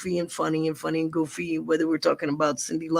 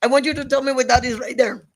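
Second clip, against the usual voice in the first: put-on higher accented teacher voice; Filipina teacher; 6 semitones higher, 4 dB louder than usual. Teacher quizzing the class on geography, accented and demanding. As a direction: point with her lips and demand. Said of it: "She used her lips".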